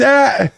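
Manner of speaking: short high yelp of surprise; a sudden high cry of discovery; surprised shriek. Real, not acted. Realizing autocorrect changed word.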